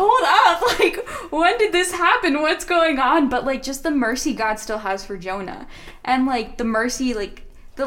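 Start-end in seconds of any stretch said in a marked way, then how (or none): 0.0-3.3 God taken aback. Retold or imitated. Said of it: "God's just like".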